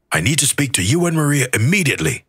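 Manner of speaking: high, fast urgent message voice; urgent text. A narrator's reading. Reeves's text demanding to see them, read high and fast. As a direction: rattle off the boss's text quickly.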